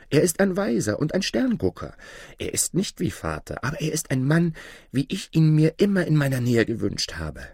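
put-on high-pitched, enthusiastic boy; eager boy Aladin, insistent. A narrator's reading. Aladin praising the stranger to his mother, eagerly insistent. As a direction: gush about him in a high voice.

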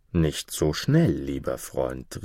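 slow, measured objection, dropping away; cautioning. A narrator's reading. Prince checks the delighted duke, cautioning. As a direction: hold your friend back, unhurried.

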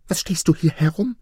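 high-pitched, urgent wife's cry; scolding wife. A narrator's reading. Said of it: "rief seine Frau".

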